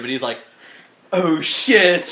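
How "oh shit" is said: louder, higher mock shock; sudden realization. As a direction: let it all hit you at once. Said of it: "it all just hit him".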